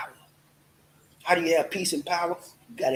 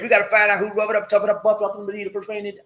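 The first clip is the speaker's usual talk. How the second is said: quick, higher rhythmic chant; said fast; 5 semitones higher, 5 dB louder, faster than usual. Shown as a demonstration. Host rattles off his catchphrase fast. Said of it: "I always like to say it fast".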